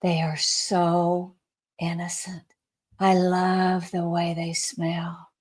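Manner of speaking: slow, soft loving praise; tender and slow. Retold or imitated. Jesus gushing softly over the newborns. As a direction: savour every word lovingly.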